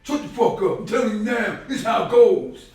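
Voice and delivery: high, loud tough-guy yell; imitating a harsh elder, high and loud; mock harsh scolding. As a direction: bark it like a hard elder. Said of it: "You gonna speak like".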